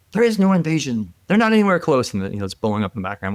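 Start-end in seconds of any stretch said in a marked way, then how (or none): 0.1-2.1 high-pitched mock official denial; lightly mimicked wartime spokesman, higher voice; Baghdad Bob. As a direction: deny it like a propaganda minister, pitched up. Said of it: "remember the Ministry of Information?".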